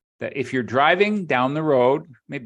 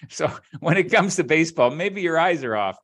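normal talking voice

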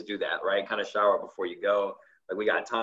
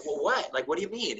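confused; 7 semitones higher than usual. Retold or imitated. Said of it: "she's like".

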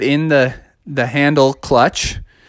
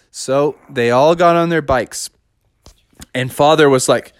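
usual speaking voice

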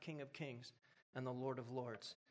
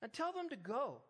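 full-force preaching; 8 semitones higher than usual. Acted. Pastor urges the congregation to command their problems, fervent preaching. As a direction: drive it home to the crowd.

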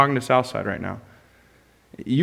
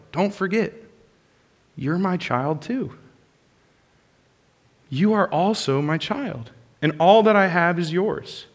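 gentle reassurance; 3 semitones higher, 4 dB quieter than usual. Acted. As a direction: comfort him gently, like a loving father. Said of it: "The father reminds the older brother".

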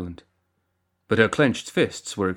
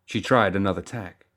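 usual speaking voice

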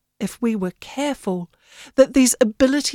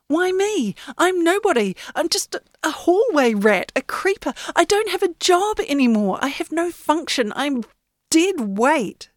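higher, insistent protest; indignant young Yenny; 7 semitones higher than usual. Acted. Yenny insisting to the great ship, indignant. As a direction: push back, stung and proud. Yenny disbelieving, calls herself nobody.

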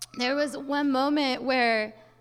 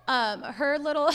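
normal talking voice

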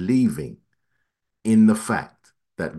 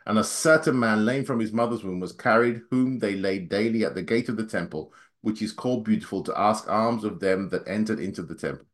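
rushed scripture reading, fast; faster than usual. Real, not acted. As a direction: hurry through the verse. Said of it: "I'll read as fast as I can".

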